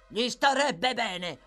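slow, loud, spiteful yell. A narrator's reading. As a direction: shout it with drawn-out contempt. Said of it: "urlò Sykes".

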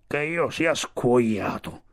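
vecchio tricheco lento e appena accennato; esclamazione del vecchio tricheco. Acted. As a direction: lenta esclamazione da tricheco burbero. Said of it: "esclamò Sivic". (un vecchio tricheco stupito, lento)

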